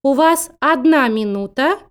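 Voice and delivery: ordinary talk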